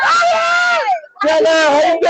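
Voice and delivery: high delighted shout of a name; excited greeting. Real, not acted. Meeting online friends in real life, high excited shout.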